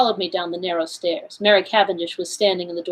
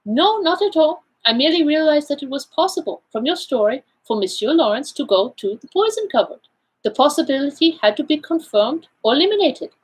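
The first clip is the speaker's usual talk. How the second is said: put-on higher-pitched accented detective, explaining; Poirot, self-assured; 8 semitones higher than usual. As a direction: play the Belgian detective laying out his findings. Figure of Poirot in read story.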